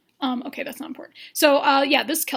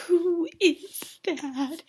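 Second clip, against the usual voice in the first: higher, extremely drawn-out question; mock dramatic awe; 4 semitones higher, much slower than usual. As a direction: stretch every word in rising amazement. Repeats question, more dramatic.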